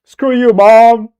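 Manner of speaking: mock yell. Son jokingly yells an insult at his mom, slow and loud. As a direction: belt it out slowly at an absent mom.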